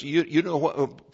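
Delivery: normal talking voice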